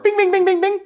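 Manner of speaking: very high, loud bouncy bings like an excited dog; excited dog noise. Retold or imitated. Expressive personality compared to a dog park pup, high excited bings. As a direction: yip it out like a hyper puppy at the park. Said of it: "like that excited dog in a dog park".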